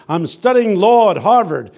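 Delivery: high, loud announcing voice; student's proud announcement. Retold or imitated. Student telling the congregation what they're doing, loud announcement.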